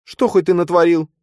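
high, loud angry question; angry demand. Acted. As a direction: snap the question at him. Nastya demanding.

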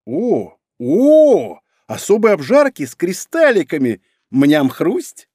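excited. Acted. Character excited over snack.

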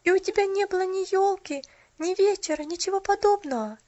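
put-on high, dismayed girl's cry; shocked, upset exclamation. A narrator's reading. Girl dismayed the shopgirl had no tree. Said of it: "воскликнула она, поражённая и расстроенная".

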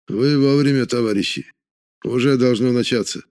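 ordinary delivery, nothing marked

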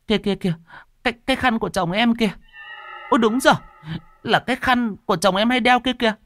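high, agitated woman's voice, pointing; a frightened wife cries out, pitched high; excited high cry. Acted. Thắm sees husband's scarf. (giọng phụ nữ cao, hốt hoảng)

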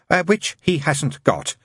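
high nun catching her slip; hasty backtrack. A narrator's reading. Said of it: "she added hastily".

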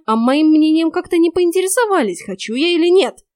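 high, loud indignant outburst; exploding in indignation. A narrator's reading. Heroine was never asked her opinion, exploding. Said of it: "взорвалась я".

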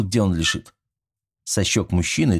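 plain reading voice

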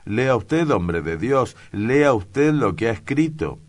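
loud, high exasperated command; a governor losing patience, calling out loud; impatient order. Acted. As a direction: push the clerk to read it out now. Impatient governor urges hesitant clerk.

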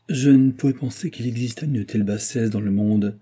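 plain reading voice

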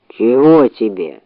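low, slow sleepy bear; drowsy low grunt, slow. Acted. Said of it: "спросил он сонным голосом".

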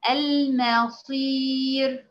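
المصير stretched very slowly, thin ra, in a recitation voice; recited example word. Shown as a demonstration. Teacher reciting المصير slowly for students. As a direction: chant المصير slowly like tajweed. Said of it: "كلمة مثلًا زي كلمة".

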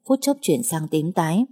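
ordinary delivery, nothing marked

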